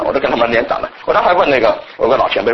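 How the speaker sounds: usual speaking voice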